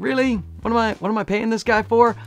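high, incredulous mock complaint; skeptical client. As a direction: imitate a client doubting the money spent. Voices doubtful paying clients.

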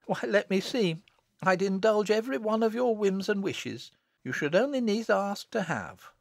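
put-on high-pitched village girl; indulgent elder sister. Elder sister humouring little Jennet, high indulgent voice. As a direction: promise treats in a high voice.

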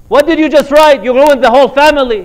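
loud, high furious yell; angry scolding. Retold or imitated. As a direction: yell at him, fast and outraged. Family scolds joking husband.

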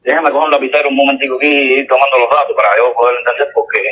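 ordinary delivery

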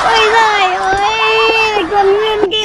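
high, slow exasperated cry; cheated hero crying out; frustrated shout. Acted. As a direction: wail at being tricked. Hero finds empty milk tea box.

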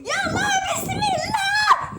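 very high, loud cry; screamed invocation. Real, not acted. Boy launching into the spin, screaming bismillah. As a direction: scream the prayer as you launch. Shouted before fast spin.

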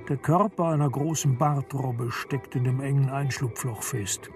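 ordinary delivery, nothing marked

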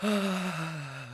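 long breathy exhale; drawn-out sigh of recovery; heavy sigh. Real, not acted. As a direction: sigh slowly to calm down. Recovering from the scare.

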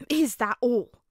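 loud, unimpressed scoff. A narrator's reading. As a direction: throw it out in open contempt. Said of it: "Bakugo scoffed".

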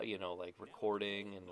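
ordinary talk, nothing marked

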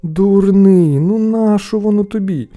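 high, loud reproach; exasperated scolding. A narrator's reading. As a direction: berate your foolish brother. Brothers scold the boy.

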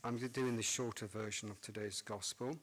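ordinary talk

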